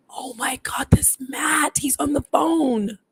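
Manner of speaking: breathy whisper rising into high voiced disbelief; hushed alarm. Telling husband the dead man is calling, hushed alarm.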